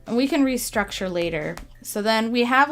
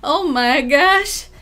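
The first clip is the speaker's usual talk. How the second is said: louder, higher, flustered and laughing; giggly and knocked off balance by praise; flustered laughing thanks; frazzled; 6 semitones higher, 7 dB louder than usual. Real, not acted. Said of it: "they got me all frazzled".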